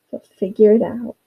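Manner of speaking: ordinary talk, nothing marked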